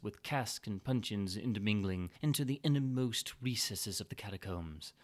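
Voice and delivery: plain reading voice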